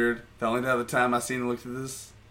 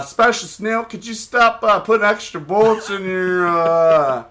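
higher, louder mock official over the tower intercom; raised-pitch tannoy-style reprimand, hammed up; intercom announcement; 6 semitones higher, 8 dB louder than usual. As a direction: imitate a range officer calling out over the intercom. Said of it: "all of a sudden over the intercom".

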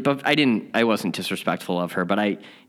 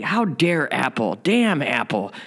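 ego ranting; 6 semitones higher than usual. Retold or imitated. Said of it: "that reptilian animal brain, that ego".

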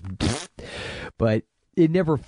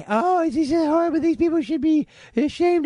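mock prissy moralizer, much higher; prim scold. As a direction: sneer it like a prudish critic. Mocking a prim critic.

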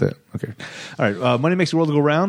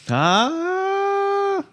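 high falsetto ah. Pretend. High sustained note after hollow remark.

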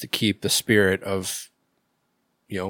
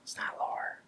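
breathy secret whisper; 5 dB quieter than usual. Real, not acted. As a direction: keep it down to a whisper. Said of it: "Why are we whispering?".

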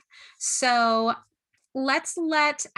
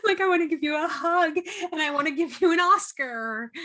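higher-pitched, playful swooning; gushing fan; 8 semitones higher than usual. Acted. Podcast host acting out fans adoring an actor, gushing. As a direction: gush like an overwhelmed fan.

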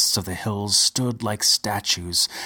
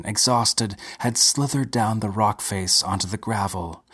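ordinary delivery

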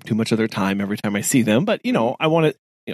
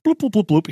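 playful bloop noises; 8 semitones higher, 4 dB louder than usual. Retold or imitated. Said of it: "they're just like".

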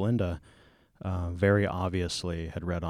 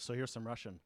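ordinary talk

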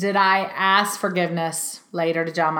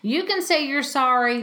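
ordinary delivery

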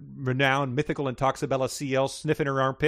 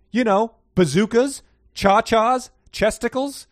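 higher and louder, gleeful rush of slang; giddy listing; 9 semitones higher, 7 dB louder than usual. Acted. Chaste listing silly slang, giddy and high. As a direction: squeal out each silly word.

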